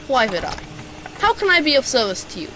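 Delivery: ordinary delivery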